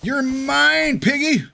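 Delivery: hammy gloating villain. Pretend. Hunting boar in game.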